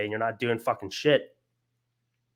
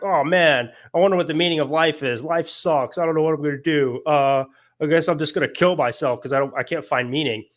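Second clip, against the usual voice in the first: bitching and complaining; 3 semitones higher, 3 dB louder than usual. Retold or imitated. Retelling a story's despairing man, mock complaining. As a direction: moan it out like a hammed-up self-pitier. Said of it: "sitting there bitching and complaining".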